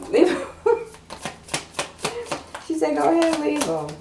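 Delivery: put-on sassy girlfriend advice. Card urges client to leave man, sassy. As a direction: egg her on to leave him.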